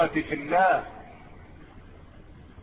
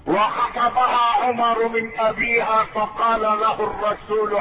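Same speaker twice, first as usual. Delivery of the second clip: ordinary talk, nothing marked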